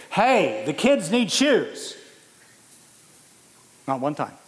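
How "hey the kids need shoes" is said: loud, high, slow mocking shout; mock yell. Preacher mocks a wife yelling from the front yard, loud and slow. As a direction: bellow it like a wife calling from the yard. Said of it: "Annie's never ... and say".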